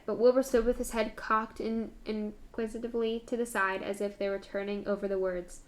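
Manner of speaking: plain reading voice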